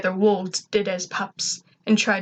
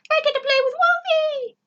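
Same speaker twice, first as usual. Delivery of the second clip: much higher, squeaky and giddy; excited robot voice. A narrator's reading. Gur thrilled to play with the wolf, giddy high squeak. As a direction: sound like a happy little robot. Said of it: "Gur said excitedly".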